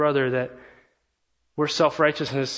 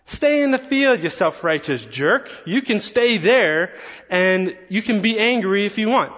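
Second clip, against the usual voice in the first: high, loud mock outburst; hammed-up father snapping back at his son; angry retort; 5 semitones higher, 3 dB louder than usual. Pastor imagining telling off his self-righteous son, mock angry. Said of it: "I'd say".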